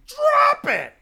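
high, loud laughing mock yell; mock shout. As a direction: yell it back, hammed up and laughing. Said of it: "and he's like".

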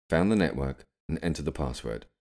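ordinary delivery

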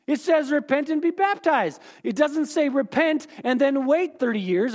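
high-pitched, scolding hardline believer; insistent church voice. Acted. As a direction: argue it like an indignant preacher. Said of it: "and they say".

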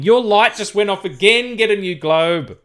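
high, loud hammed-up character line; loud mock quote. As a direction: belt out the quote.

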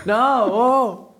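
high laughing groan of disgust; squealing laugh of disgust. Real, not acted. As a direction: laugh out a grossed-out no.

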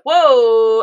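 loud, drawn-out whoa; awestruck, stretched-out exclamation; amazed whoa. Retold or imitated. Retells amazed reaction to the show.

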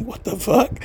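stunned disbelief. Retold or imitated. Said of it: "I'm like".

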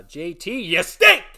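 high, loud mocking yell; angry yell. Host yelling at J T, high loud angry yell. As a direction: spit out the insult loudly. Insulting J T.

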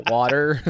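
"Water" said loudly with a full final R; a demonstrative, R-heavy "Water"; pronounced R. Shown as a demonstration. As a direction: hit the R at the end of "Water". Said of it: "You gotta get that R".

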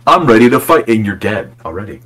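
normal talking voice

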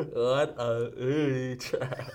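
very slow, mangled through a mouthguard; wearing a mouthguard. Real, not acted. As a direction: drag it out past a mouthguard.

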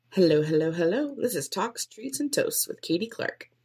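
bright sing-song host greeting; upbeat podcast intro. Shown as a demonstration. Podcaster testing her intro, upbeat host voice. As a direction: pitch it like an energetic show opener.

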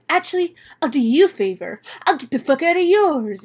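retold fed-up outburst; dad losing it. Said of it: "he loses it on Benny".

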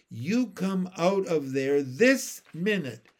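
bossy big-sister command; stern order. A narrator's reading. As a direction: order a small child out, loud and emphatic.

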